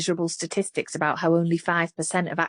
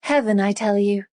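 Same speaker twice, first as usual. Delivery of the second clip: mock-grand stage voice, a bit louder; theatrical declaiming; 3 semitones higher, 4 dB louder than usual. A narrator's reading. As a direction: keep hamming it up like on stage. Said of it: "in her best theatrical voice".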